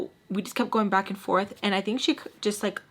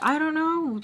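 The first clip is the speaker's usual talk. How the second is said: retold yell; 8 semitones higher than usual. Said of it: "we start yelling at each other".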